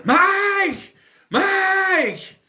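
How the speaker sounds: very high, loud drawn-out shriek; imitated yelling. Playing the film in the basement, yelling. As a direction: mock-scream the name as if lost. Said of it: "start yelling".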